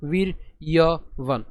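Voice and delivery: বীর্যবান very slowly as vir, ya, van; বীর্যবান with a separate y-glide; syllable-by-syllable. Shown as a demonstration. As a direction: break বীর্যবান into three parts. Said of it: "তাহলে উচ্চারণ কেমন".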